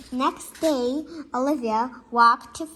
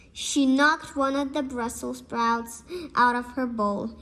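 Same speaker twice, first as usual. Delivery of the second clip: usual speaking voice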